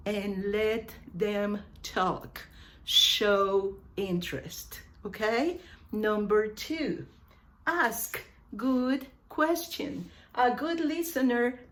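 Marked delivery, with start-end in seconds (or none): none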